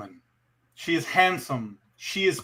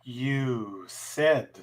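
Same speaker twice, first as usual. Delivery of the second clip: sultry narrator; 4 semitones lower, slower than usual. Acted. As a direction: purr it like a seductress. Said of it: "repeat it in your sultry voice".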